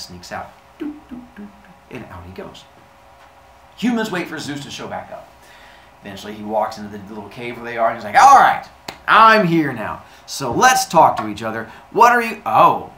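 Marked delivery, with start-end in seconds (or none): 0.8-1.5 high, soft tiptoe doots; light, hushed step sounds; sneaking noises. Acted. Imitating sneaking out. 8.2-12.9 big, booming, loud entrance; loud, self-important arrival; Zeus. Acted. Zeus makes his entrance.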